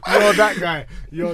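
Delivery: laughing loudly at a mate. Real, not acted. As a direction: crack up while ribbing him. Teasing a friend, laughing loudly.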